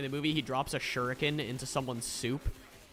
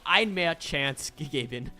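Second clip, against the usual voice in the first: laughing through German; 5 semitones higher, 5 dB louder, slower than usual. Retold or imitated. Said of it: "I'll be like".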